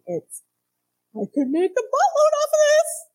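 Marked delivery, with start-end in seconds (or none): 1.1-3.1 high, loud greedy excitement; greedy glee. Retold or imitated. Peter dreaming of a boatload of fish, high greedy glee. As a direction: gloat over the profit, pitched up high.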